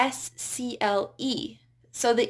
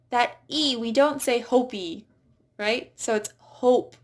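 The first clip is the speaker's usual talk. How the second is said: usual speaking voice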